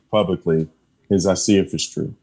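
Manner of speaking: usual speaking voice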